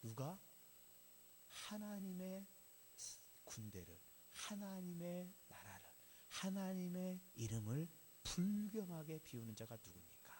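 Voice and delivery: put-on stretched-out, measured declaration of the boy David; young David speaking. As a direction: draw out each holy phrase with weighty pauses. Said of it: "그러면서 뭐라고 합니까?".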